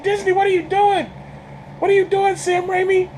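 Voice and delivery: high-pitched, incredulous mock wail; appalled outcry. Viewer calling out the studio and director over the gore, appalled high wail. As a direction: plead in hammed-up disbelief.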